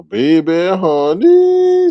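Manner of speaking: high, stretched, loud final word; drawn-out catchphrase. Shown as a demonstration. Host obliging a viewer's request, drawn-out catchphrase. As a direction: let the last word soar and hang. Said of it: "until I hear you say, "Baby, honey".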